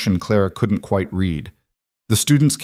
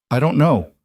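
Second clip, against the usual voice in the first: shaking voice; 6 semitones higher than usual. A narrator's reading. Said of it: "her voice shook".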